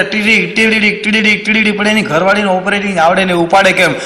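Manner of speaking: imitated phone ringtone. Phone rings unanswered at home, imitated ringtone. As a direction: mimic a ringing mobile in quick syllables. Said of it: "ring વગડે".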